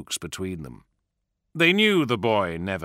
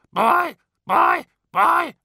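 high loud cackling crow voice; 7 dB louder, much slower than usual. Acted. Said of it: "they cackled".

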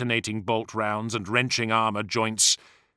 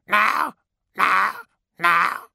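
crow calls; 9 semitones higher, 5 dB louder, much slower than usual. Acted. Crows keep calling.

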